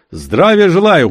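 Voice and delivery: loud, high military bark; barking greeting. Acted. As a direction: bark it like a soldier. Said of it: "гаркнул Цыбулька".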